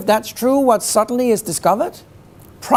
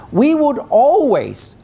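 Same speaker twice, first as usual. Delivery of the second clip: "always" said slowly and louder, higher; stress on always; 5 semitones higher, 3 dB louder, slower than usual. Shown as a demonstration. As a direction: lean hard on "always". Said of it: "and I emphasize the word always".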